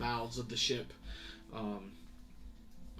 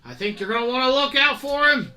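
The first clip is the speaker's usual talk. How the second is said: much higher, loud put-on sailor voice; nervous sailor; 14 semitones higher, 19 dB louder than usual. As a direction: alert the captain, uneasy and urgent. Sailor reports wanted man.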